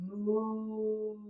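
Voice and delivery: playful barnyard moo; mooing cow. Pretend. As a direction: moo like a gentle cow for the kids. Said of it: "I'm gonna make the noises".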